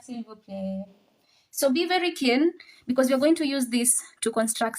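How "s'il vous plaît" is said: s'il vous plaît dropped much softer; trailing off. Real, not acted. Teacher's repeated request fading out. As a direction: let s'il vous plaît fade away. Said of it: "repeated request".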